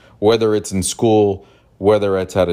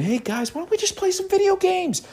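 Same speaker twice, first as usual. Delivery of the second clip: put-on much higher, sweet child's plea; little kid voice. Child stepping between fighting adults, high kid voice. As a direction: play a seven-year-old calming the adults. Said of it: "I said it just like that at seven".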